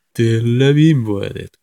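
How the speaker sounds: loud, slow Nagoya dialect; 名古屋弁. Shown as a demonstration. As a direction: declare it emphatically in Nagoya intonation. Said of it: "名古屋弁っぽい言葉を喋ってみて". (名古屋弁で大きくゆっくり)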